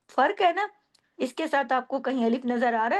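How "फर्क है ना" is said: usual speaking voice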